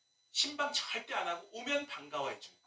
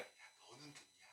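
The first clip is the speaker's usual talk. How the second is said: whispered self-question; 4 semitones lower, 25 dB quieter, slower than usual. Retold or imitated. Asking himself in the mirror.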